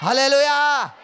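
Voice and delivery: full-throated, high, loud hallelujah; shouted hallelujah. Real, not acted. Preacher leading the congregation in praise, full shout. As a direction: ring it out to the back row. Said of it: "ఇంకా గట్టిగా చెబుదాం".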